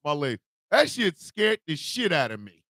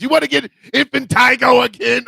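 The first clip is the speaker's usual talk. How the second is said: mother's scolding shout, higher and louder; imitating his mother; 7 semitones higher, 9 dB louder than usual. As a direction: bark the warning like mom. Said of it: "My mother says".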